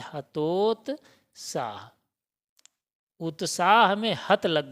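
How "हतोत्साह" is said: हतोत्साह drawn out very slowly; teacher modelling हतोत्साह slowly; slow model word. Shown as a demonstration. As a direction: stretch हतोत्साह syllable by syllable. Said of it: "क्या होता है?".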